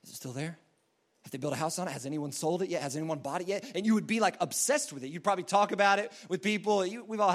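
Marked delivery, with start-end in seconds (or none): 0.0-3.6 furtive wondering. Acted. Acts out obsessed checking of lot.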